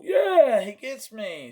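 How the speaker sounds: loud, high yeah that drops away; mock whoop of agreement; enthusiastic fan reaction. Fan reacting to a meme, mock enthusiasm. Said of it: "Some people will go".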